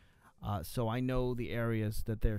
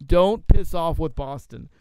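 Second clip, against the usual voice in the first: loud, slow, higher-pitched warning; emphatic warning; 5 semitones higher, 12 dB louder than usual. Acted. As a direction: hammer out the punchline as a threat. Punchline of quoted rant.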